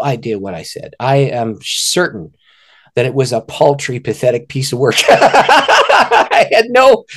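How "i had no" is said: slow, high laughing words. Real, not acted. Admitting his pathetic prayer, laughing. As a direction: laugh through the words, drawn out.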